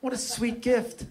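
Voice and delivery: slow, high, soft cooing aw; gentle mock-touched coo. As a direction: gush softly over something sweet. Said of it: "were actually like".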